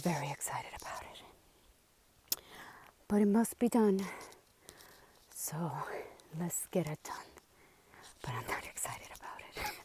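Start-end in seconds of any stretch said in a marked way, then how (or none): 0.0-2.4 slow, unvoiced whisper of reluctance. Real, not acted. Tired hiker before a climb. 5.3-9.7 whisper. Real, not acted. Dreading the four mile climb.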